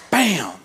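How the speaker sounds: sudden loud bam, like a hand slamming dirt; telling the congregation his childhood mishap with a loud bam; mock impact bam. Boy hitting dirt pile.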